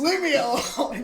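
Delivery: playfully irritated; mock annoyed. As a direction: brush them off laughing. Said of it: "I'd be like".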